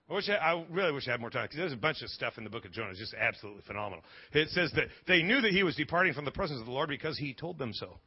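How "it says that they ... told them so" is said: ordinary delivery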